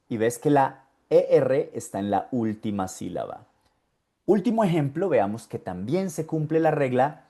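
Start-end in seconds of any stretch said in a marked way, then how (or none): none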